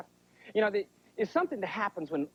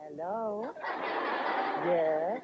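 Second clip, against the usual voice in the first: sudden mood change; much slower than usual. Retold or imitated. Mom answering the phone mid-rant, suddenly sweet. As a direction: switch to a pleasant phone voice. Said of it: "How do they do that mood change?".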